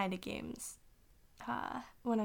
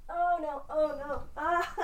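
strained high panicked cries mid-handstand; losing balance, yelping; alarmed; 7 semitones higher, 5 dB louder, slower than usual. Real, not acted. Struggling to do a handstand.

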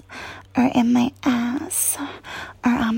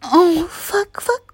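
normal talking voice

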